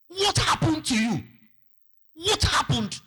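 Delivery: high, loud cry of alarm; alarmed exclamation. Acted. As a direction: cry out in shock, pitch leaping up. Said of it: "exclaimed in alarm and said".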